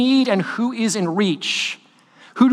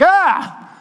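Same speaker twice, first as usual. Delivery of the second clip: sharp high startled ah; startled yelp; 10 semitones higher than usual. Retold or imitated. Pastor retelling being startled, high startled yelp. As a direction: yelp as if caught off guard. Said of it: "we're like".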